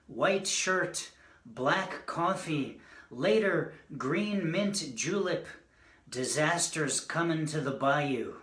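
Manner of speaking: slow, measured recital in separate phrases; poetry reading. Acted. Poet reading a short premonition poem to an audience, measured recital. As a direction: space out each image as you recite.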